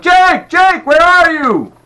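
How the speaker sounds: put-on high, loud shout for a missing child; calling out. A narrator's reading. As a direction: yell the name down the mall.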